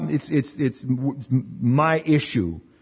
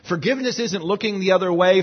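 ordinary talk

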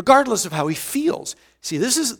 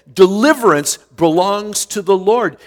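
firm declaration; 3 semitones higher, 6 dB louder than usual. Retold or imitated. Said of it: "So I'll say".

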